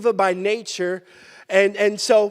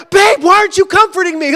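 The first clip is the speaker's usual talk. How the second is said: mock whine; 8 semitones higher, 7 dB louder, faster than usual. Retold whining at wife.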